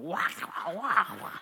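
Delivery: mock wobble sound, wah wah; wobbling wings. To the congregation, the plane's wings wobbling, mock wah sounds. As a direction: imitate the wings flapping with a wavering wah. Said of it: "the wings are going".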